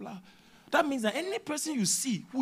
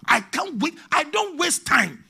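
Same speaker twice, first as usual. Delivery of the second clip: eager exclamation; 10 semitones higher, 11 dB louder, slower than usual. Retold or imitated. Eager to meet the Lord.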